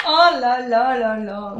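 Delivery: oh là là lent et fort; s'extasier lentement; émerveillée. Real, not acted. (émerveillement)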